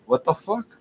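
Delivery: normal talking voice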